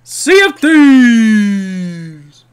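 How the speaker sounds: high, loud, drawn-out show announcer; announcer shout. Acted. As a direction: belt out the title like a ring announcer, stretching every word.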